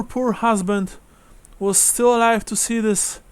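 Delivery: put-on high-pitched old widow, overcome with gratitude; frail old lady pouring out thanks; grateful old woman. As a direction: lift the voice high, lamenting and thankful. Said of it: "she says".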